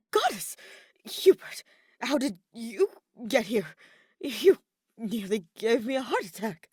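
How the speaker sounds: sudden high yelp, then breathless and loud; startled. A narrator's reading. Ferdinand startled by Hubert on the dark balcony, yelping. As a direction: jump as if caught off guard. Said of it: "nearly jumped out of his skin".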